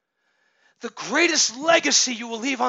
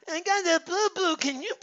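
put-on high whiny little boy voice; whiny toddler; 7 semitones higher than usual. Father imitating his son whining over a scrape. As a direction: whimper it like a small child wanting pity.

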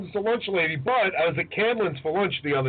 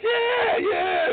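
very high mock-eager yeah; squealed comic agreement; excited yes. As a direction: imitate an over-thrilled reply, voice way up. Retold reply to recognition.

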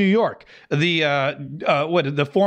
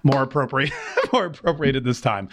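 laughing; 4 semitones lower than usual. Real, not acted. Chuckling at his own joke.

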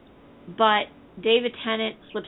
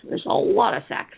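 whispered aside, higher; hushed repeat for emphasis; stage whisper; 13 semitones higher than usual. Acted. Repeats the line, second time whispered.

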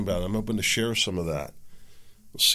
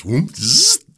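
loud, high whooshing blender buzz; blender noise; 12 semitones higher, 12 dB louder than usual. Retold or imitated. Showing viewers his juicer, loud mock blender buzz. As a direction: make the blender whirr and buzz.

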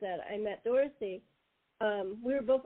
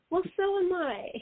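playful reply; 8 semitones higher than usual. Retold or imitated. Dorothy's playful quote.

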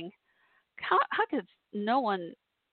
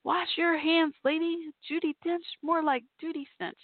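mock yelling; 8 semitones higher than usual. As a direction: yell it after her across the hall. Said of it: "yelling at the top of your lungs".

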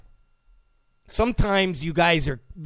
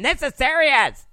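much higher, squeaky mock talk-radio host; pitched-up parody of a serious pundit; Mark Levin impression; 12 semitones higher than usual. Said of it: "Mark Levin".

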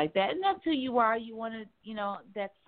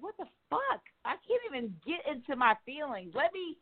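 mock frustrated self; 8 semitones higher than usual. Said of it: "And I'm like".

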